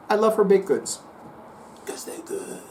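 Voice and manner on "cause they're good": soft whisper, a secret; whispered aside. Acted. Psychic whispering why he loves the baked goods. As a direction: lean in and whisper it.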